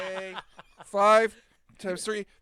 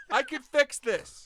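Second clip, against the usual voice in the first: insistent; 7 semitones higher, 6 dB louder, much slower than usual. Acted. Repeated chant grows.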